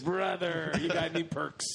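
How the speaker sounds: gruff wrestler voice; Hulk Hogan voice. Pretend. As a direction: hype wrestler bark.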